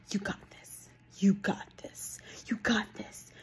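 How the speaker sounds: mocking breathy whispered pep talk; repeated mock encouragement, whispered. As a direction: whisper the cliché like a parody. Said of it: "how I hate that phrase".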